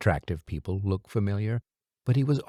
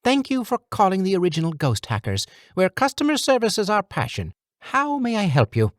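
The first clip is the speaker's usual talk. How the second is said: high, fast, eager customer service voice; chirpy phone helpline voice; polite meek voice; 10 semitones higher, 3 dB louder than usual. A narrator's reading. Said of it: "In a polite, meek voice".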